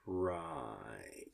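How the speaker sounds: very low, soft, drawn-out reply; acted slow, unconvinced murmur; sceptical acknowledgement. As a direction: stretch it out doubtfully under your breath. Sceptical reply to confused staffer.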